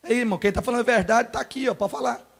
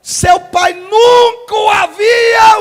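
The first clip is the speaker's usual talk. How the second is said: preaching shout; 8 semitones higher, 9 dB louder, much slower than usual. Real, not acted. Preaching climax, loud.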